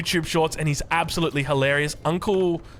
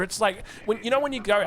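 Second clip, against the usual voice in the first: ordinary talk, nothing marked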